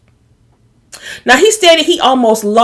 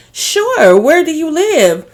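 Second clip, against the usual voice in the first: much higher, sweet and fake-helpful; a high, syrupy society girl; sugary Paris voice; 6 semitones higher, slower than usual. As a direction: play the too-eager helper offering a ride. Paris voiced offering ride.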